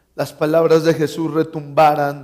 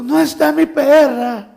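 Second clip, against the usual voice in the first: high, tearful child imitation; mock a bawling kid; wailing child; 9 semitones higher, 4 dB louder than usual. Child wailing to visitors about a lost dog, mock crying. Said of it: "un niño llorando".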